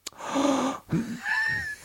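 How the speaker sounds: sharp breath in; a startled intake of breath; shocked gasp. Retold or imitated. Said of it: "And I said".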